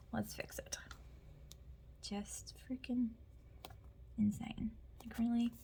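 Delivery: soft whisper to herself; whispered aside. Real, not acted. Talking to herself while she fixes it, whispered. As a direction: barely voice it, just for yourself.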